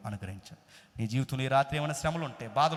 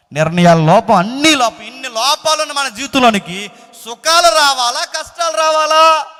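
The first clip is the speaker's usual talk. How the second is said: full-force preaching; 7 semitones higher, 17 dB louder than usual. Real, not acted. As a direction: pile up the faults, then cry out to the crowd. (పూర్తి గొంతుతో ఉద్వేగ ప్రసంగం)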